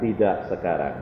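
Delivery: low, soft, measured no; calm divine refusal. Retold or imitated. God answering the believer's demand, calm and low. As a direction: refuse quietly in a deep voice.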